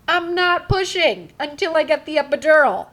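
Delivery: loud, high, stubborn refusal; defiant refusal. Retold or imitated. Patient refusing to push without epidural, loud defiance. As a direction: dig in and refuse loudly.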